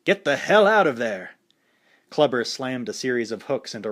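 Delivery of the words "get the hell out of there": a louder yell from the corner. A narrator's reading. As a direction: shout at the fighter to get out. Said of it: "Apollo yelled futilely".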